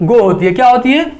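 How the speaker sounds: ordinary talk, nothing marked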